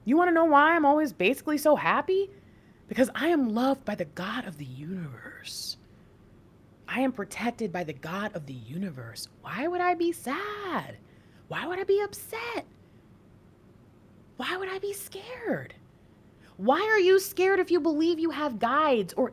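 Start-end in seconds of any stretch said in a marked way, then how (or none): 2.9-9.3 hushed, breathy confession, voice dropped low; soft whisper. Real, not acted. Telling viewers why she is always happy, whispering. Confiding her secret to happiness. 9.4-15.7 soft rhetorical question. Real, not acted.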